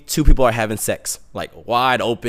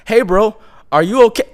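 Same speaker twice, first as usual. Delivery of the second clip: high, louder passer-by voice; imitated bystander checking in; concerned stranger; 9 semitones higher, 5 dB louder than usual. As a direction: call over as a worried stranger. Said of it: "this Russian and German couple".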